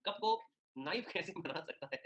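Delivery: slow, drawn-out incredulous question; slow amused disbelief. Real, not acted. Teacher mocking the absurd statement, slow and amused. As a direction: stretch it out, baffled and amused. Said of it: "हंसी आ रही है".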